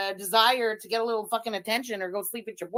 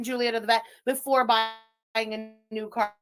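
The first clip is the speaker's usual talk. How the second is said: usual speaking voice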